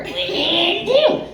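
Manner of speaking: high mimicked catchphrase; quoted catchphrase. Comic quotes a reference for fans, in a high put-on voice. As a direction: pitch it up high like a quoted gag. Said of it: "If you understand what that is from".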